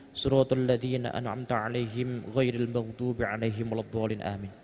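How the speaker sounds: low, soft, even prayer murmur; hushed, running liturgical recital; Al-Fatihah recitation. Real, not acted. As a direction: recite the prayer quietly on one level tone.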